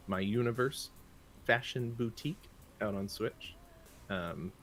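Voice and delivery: ordinary delivery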